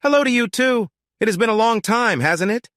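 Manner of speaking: bright, high, breezy greeting; chirpy high hello; cheerful. A narrator's reading. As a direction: greet them cheerfully, unbothered. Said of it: "I replied cheerfully".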